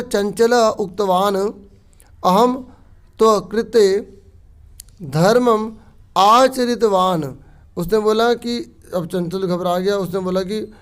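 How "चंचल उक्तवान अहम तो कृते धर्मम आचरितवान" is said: slow, louder and higher reading; loud Sanskrit recitation. Shown as a demonstration. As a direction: declaim the line to the class. Teacher reads Sanskrit text aloud.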